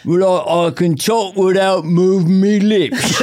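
high, slow, proud peasant voice with a laugh; ventriloquist. Retold or imitated. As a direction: brag about his one odd skill. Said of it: "a ventriloquist".

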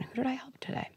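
put-on hushed, as the child's own thought; inner voice. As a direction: breathe it like a thought in the head. Kid's inner voice heard.

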